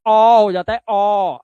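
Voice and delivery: loud emphatic demo. Shown as a demonstration. Teacher stressing the new vowel to students. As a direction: call out the आ sound. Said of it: "अब देखो आ कैसे हो गया".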